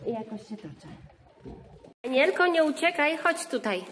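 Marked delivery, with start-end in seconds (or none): 2.0-3.8 calling out. Real, not acted. Mother calling runaway toddler.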